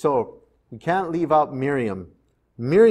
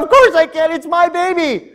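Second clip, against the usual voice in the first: much higher, loud, gleeful inner voice; bursting with secret delight; inner joy; 14 semitones higher, 8 dB louder than usual. Acted. The mother's thought on getting her son back, high joyful outburst.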